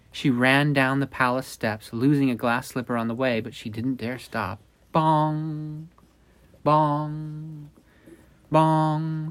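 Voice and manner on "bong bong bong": high held bong like a clock bell; clock chime. Acted. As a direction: ring it out like a tolling clock. Clock strikes midnight.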